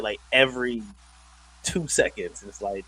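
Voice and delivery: normal talking voice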